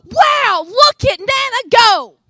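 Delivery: loud, slow, amazed shout; awed wow. Retold or imitated. Grandson cheering on his grandmother, loud and amazed. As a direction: belt out a child's wonder. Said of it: "wide open look of".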